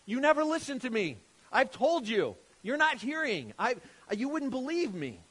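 a teacher fed up, telling them again; exasperated rebuke. Acted. Jesus voiced rebuking unbelieving crowd.

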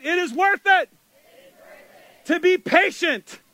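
preacher leads congregation in motto, loud and high; call and response. Shown as a demonstration. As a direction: lead the crowd in it with energy. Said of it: "Okay, let's say it".

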